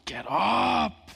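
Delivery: put-on high, loud bellow to get kids moving; forceful parental yell up the stairs; belting wake-up shout. Shown as a demonstration. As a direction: belt it out like waking lazy kids. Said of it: "really belt it out so that they'll move".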